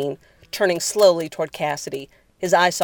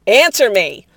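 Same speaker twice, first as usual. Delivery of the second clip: loud, higher-pitched command; shouted demand; 5 semitones higher, 9 dB louder than usual. Acted. Cassidy reaching out to seize Jared, shouting. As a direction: demand an answer forcefully.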